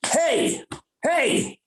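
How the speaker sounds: high-pitched retold hail; calling for attention. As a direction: call out to someone zoned out. Someone suddenly calls out to him.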